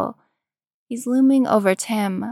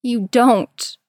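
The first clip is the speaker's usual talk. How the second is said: louder, urgent insistence with a touch of a crack; insist, on the edge of breaking; 3 semitones higher, 5 dB louder than usual. A narrator's reading. Tim begs Jason to stop, voice cracking slightly. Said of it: "Tim's voice cracks".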